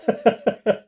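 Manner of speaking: short high chuckle; amused laugh. Real, not acted. Player amused by the surplus card, high laugh. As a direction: laugh lightly at the overkill. Amused by drawing more law.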